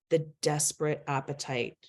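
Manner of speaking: normal talking voice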